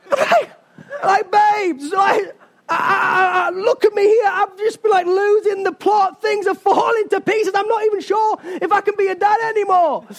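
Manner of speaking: high, wailing comic panic; hammed-up meltdown. Husband losing the plot at his wife in Meadowhall, hammed-up wailing meltdown.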